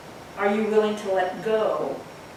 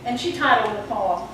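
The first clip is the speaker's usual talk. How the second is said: normal talking voice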